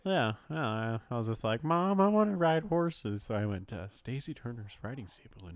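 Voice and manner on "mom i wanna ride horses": put-on high, slow pleading child; whiny kid voice. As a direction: beg like a little kid. Childhood request to mom.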